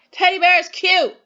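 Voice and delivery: loud, high exclamation; with emotion. Shown as a demonstration. Teacher testing pupils on exclamation points, emotional exclaiming. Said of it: "saying it with some emotion".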